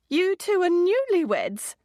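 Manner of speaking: British woman blurting in delighted surprise; blurted out. A narrator's reading. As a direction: burst out with sudden excitement. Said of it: "blurted the first British woman".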